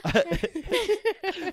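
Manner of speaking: loud, slow Spanish with long rolled R; exaggerated Spanish. Showing bacteria in Spanish, exaggerated Spanish trill. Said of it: "How do you say bacteria in Spanish".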